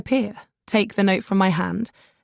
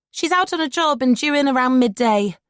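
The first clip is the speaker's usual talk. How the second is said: piping up; 6 semitones higher, 3 dB louder than usual. A narrator's reading. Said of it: "pipes up one of the women".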